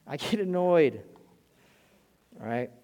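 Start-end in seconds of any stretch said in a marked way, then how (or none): none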